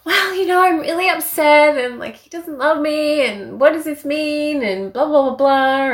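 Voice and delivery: high, whining mock self; whiny complaining. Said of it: "So I started being like".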